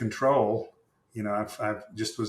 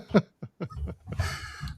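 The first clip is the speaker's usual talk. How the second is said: soft unvoiced laugh; real amused laugh; airy laugh; 7 semitones higher, 3 dB quieter than usual. Real, not acted. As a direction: chuckle after the punchline. Guest laughs after punchline.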